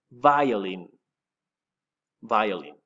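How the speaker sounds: "violin" as VY-o-lin, falling at the end; English pronunciation. Shown as a demonstration. Teacher asks pupils to repeat, "violin" said the English way. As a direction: model "violin" for repeating, starting on "vie". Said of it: "Repeat it. Say it".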